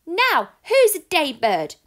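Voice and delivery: high, loud triumphant shout. Acted. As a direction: shout it out into the night. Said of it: "he shouted at the darkness".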